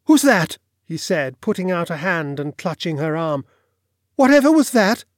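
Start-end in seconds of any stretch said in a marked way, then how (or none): 0.0-0.6 high, loud startled demand; startled alarm. A narrator's reading. Lodger startled by a cough, sharply alarmed. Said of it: "started violently". 4.1-5.0 high, loud, alarmed question; startled alarm. A narrator's reading. Lodger clutching landlady's arm at a cough, alarmed. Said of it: "started violently".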